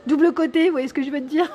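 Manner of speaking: real giggle while joking about it; laughing. Real, not acted.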